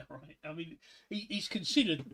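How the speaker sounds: ordinary talk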